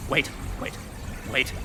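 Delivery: urgent whispered wait, wait, wait; nervous whisper. Acted. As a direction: hush them under his breath. Stopping before a risky act.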